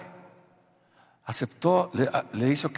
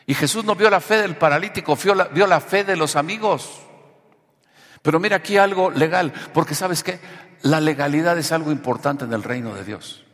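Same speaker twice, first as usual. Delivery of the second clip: higher, emphatic sermon delivery; forceful preaching; 5 semitones higher, 8 dB louder than usual. Real, not acted. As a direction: drive the teaching home to the congregation. Preaches on the passage.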